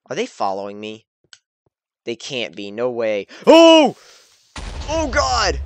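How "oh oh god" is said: sudden loud high yelp; startled scream. Real, not acted. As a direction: jump out of your skin. Creeper explodes nearby.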